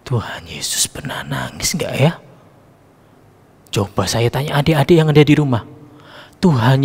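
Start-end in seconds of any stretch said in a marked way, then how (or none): 0.0-2.2 whisper. Shown as a demonstration. Same question repeated as whisper.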